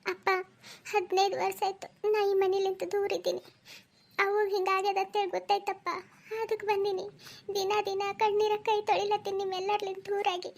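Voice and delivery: high, wounded plea to an angry father; estranged daughter's sorrowful appeal; tearful pleading. Acted. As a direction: beg for forgiveness in a high voice.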